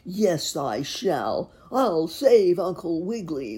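loud, high-starting ringing declaration; whinny it boldly, stretching the words; loud, heroic horse. A narrator's reading. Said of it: "whinnied the horse".

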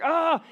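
sharp, high, loud frustrated "Ah!"; frustrated yell. Retold or imitated. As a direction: blurt out Charlie Brown's exasperated cry. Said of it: "And he's like".

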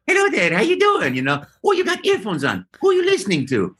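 high, friendly Mario greeting; chirpy Italian plumber chat. Retold or imitated. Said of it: "I might have just talked to everybody".

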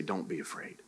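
soft, low, tender reassurance; loving voice. Acted. Preacher voicing Jesus comforting the sinner, lovingly. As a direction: hush it into gentle comfort. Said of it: "heard his loving voice say".

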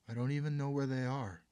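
high, loud upset protest. Acted. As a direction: cry out in helpless panic. Daughter protests being sent away.

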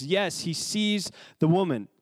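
normal talking voice

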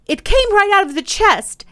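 high, loud startled protest; alarmed. A narrator's reading. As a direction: excuse your fright, pitched high. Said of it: "as if to excuse his alarm".

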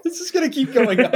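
high laughing voice. Real, not acted. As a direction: laugh through the words. Laughing at escalating joke.

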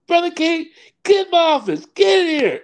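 high-pitched mock call across a room; imitated pastor's summons. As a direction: call him in urgently, high and insistent. Pastor summoning him into office.

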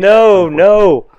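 emphatic no, no; loud denial. Real, not acted. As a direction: insist loudly.

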